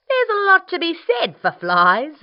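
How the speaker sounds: loud, high, eager dingo; booming sly dog character. Acted. Dingo voiced.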